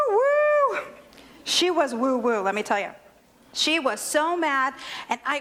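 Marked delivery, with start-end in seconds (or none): 0.1-0.8 high, loud sustained "woo"; shrill-pitched whistle hoot; cartoon steam whistle. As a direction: imitate steam shooting from the ears. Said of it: "cartoons where they're steam and you hear the woo".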